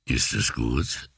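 low, slow creepy flirt; sleazy low voice. Acted. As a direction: lean in and rasp it. He keeps pestering her. (tiefe, schmierige Anmache)

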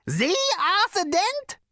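very high, loud, rising squeals; astonished delight. Acted. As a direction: squeal in disbelief. Prak recognises Arthur, delighted.

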